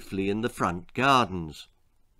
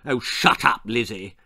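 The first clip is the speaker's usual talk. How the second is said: put-on mildly cross boy's snap; brotherly bark; 2 semitones lower than usual. Brother silencing his little sister, barked. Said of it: "barked Milo".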